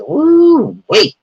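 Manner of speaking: high, loud sudden whoop; excited whoop. Real, not acted. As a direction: burst out with a whoop. Excited outburst.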